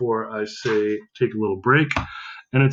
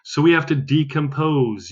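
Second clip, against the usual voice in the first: usual speaking voice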